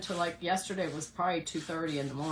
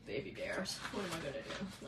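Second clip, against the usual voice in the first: ordinary delivery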